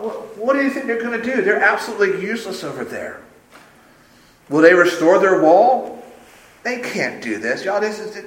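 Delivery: mocking, incredulous contempt; sarcastic jeering. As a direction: belittle them like a sneering rival. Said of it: "We can hear the sarcasm dripping off at each syllable".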